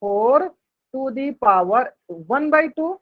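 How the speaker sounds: four to the power one by two, stretched slowly with pauses; teacher reading the expression slowly; slow demo reading. Shown as a demonstration. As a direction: read out each word slowly. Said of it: "इसको उसी प्रकार से पढ़ते हैं".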